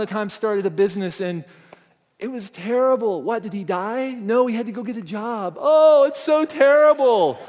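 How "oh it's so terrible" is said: mock horror. Mocking fear of failure.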